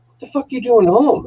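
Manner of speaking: high, mock-shocked question; incredulous friend. Telling friends how he reacted, high mock disbelief. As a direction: act floored that he's home already. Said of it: "and I'm like".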